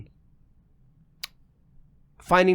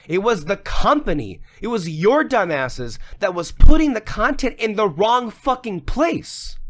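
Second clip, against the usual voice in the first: heated rant, loud and higher; yelling; 5 semitones higher, 4 dB louder than usual. Real, not acted. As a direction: yell it out in frustration. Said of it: "Why are you yelling?".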